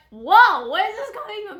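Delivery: startled cry that jumps up high; taken aback, voice flying up; shocked whoa. Retold or imitated. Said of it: "And I'm like".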